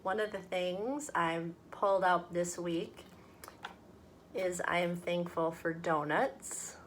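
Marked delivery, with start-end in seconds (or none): none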